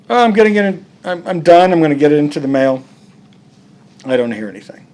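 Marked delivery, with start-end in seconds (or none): 0.1-2.8 a higher, louder, hurried false promise; mock eager excuse. The writer stalling on the late issue, a mock eager excuse. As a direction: gush excuses to cover the delay.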